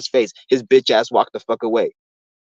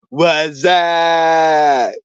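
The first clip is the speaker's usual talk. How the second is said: long drawn-out goofy yell; movie catchphrase shout; 3 semitones higher than usual. Acted. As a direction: holler it like the movie gag, stretching the last vowel. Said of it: "Two thousand screen movie back in the nineties".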